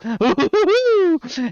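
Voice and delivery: high cackling laugh; mad cackle. Acted. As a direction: cackle madly like an old eccentric. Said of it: "He just cackles madly".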